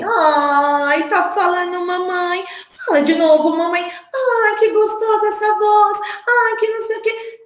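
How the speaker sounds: high, melodic motherese; delighted mother's melody. Retold or imitated. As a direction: gush over baby's first word in a high singsong. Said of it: "essa melodia, essa entonação da fala da mãe".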